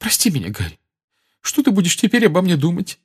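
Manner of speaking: high, shaky tearful apology; trembling voice. Acted. Mrs Weasley apologising to Harry with a trembling voice. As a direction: quaver through it. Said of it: "дрожащим голосом проговорила она".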